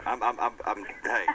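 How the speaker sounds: real stuttering, words stuck repeating. Real, not acted. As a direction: stumble over the words, stuttering. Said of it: "stuttering and everything".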